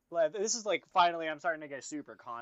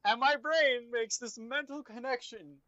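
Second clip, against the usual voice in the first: normal talking voice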